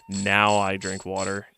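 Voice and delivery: ordinary talk, nothing marked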